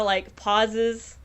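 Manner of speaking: ordinary delivery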